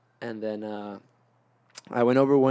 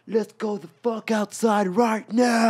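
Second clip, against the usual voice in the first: higher, louder, drawn-out hyped shout; amped-up yell; 9 semitones higher, 4 dB louder, much slower than usual. Retold or imitated. High on meth, telling friends to go outside, amped shout. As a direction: explode with sudden rush of energy. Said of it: "to, like".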